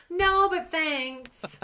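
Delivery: high, drawn-out "no, but thanks"; mock polite refusal. Guest turning down the kids' games, mock polite reluctance. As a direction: stretch out an awkward pretend decline.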